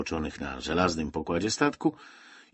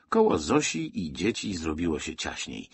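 usual speaking voice

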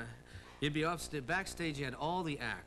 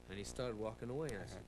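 usual speaking voice

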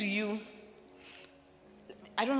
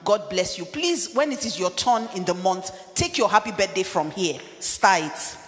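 impassioned sermon voice; full-force preaching; 3 semitones higher, 3 dB louder than usual. Acted. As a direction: preach it hard to the congregation.

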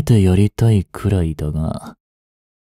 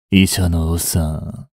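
ordinary talk, nothing marked

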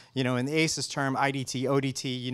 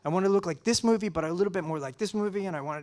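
higher, eager voice piling on requests; imitating Yvonne; 6 semitones higher than usual. Imitating the colorist asking Matt for a look, higher pitched. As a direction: do the colorist rattling off wishes.

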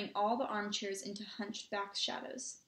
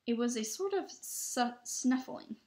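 usual speaking voice

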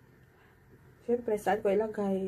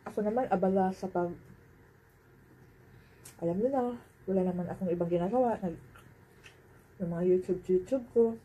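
chewing while talking; muffled mid-chew speech; mouth full. Real, not acted. Seen 2: Cheeks bulge, lips pursed chewing between words after bite at -1s.

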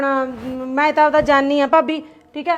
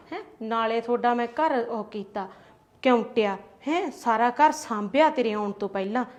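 much lower and softer, aggrieved grumble; sulky muttering; 5 semitones lower, 8 dB quieter than usual. Acted. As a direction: mutter your hurt while packing. Sulking, grumbling aside. (ਰੁੱਸ ਕੇ ਹੌਲੀ ਹੌਲੀ ਬੁੜਬੁੜ)

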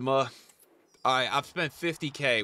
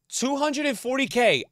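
incredulous; 8 semitones higher than usual. Real, not acted. Repeating the price to chat.

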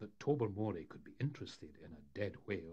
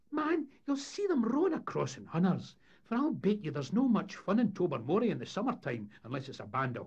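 high, loud Scots character voice; eager schemer; 8 semitones higher, 8 dB louder than usual. Acted. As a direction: rattle out a money-making plan with gusto. Voices Sonny Jim pitching scheme.

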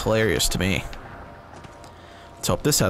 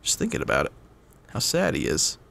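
ordinary talk, nothing marked

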